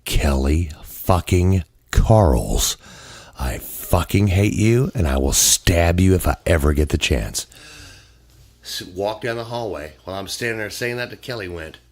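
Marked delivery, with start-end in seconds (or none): 0.1-7.5 loud, slow, seething threat; furious outburst. Retold or imitated. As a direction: spit each word out in rage. Vice president's furious outburst.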